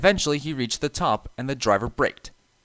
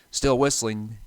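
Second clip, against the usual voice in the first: plain reading voice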